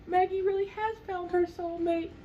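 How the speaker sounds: very high, wondering delivery; amazed. Retold or imitated. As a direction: mom realising her daughter found a twin, high amazement. Mom marvelling after gasp.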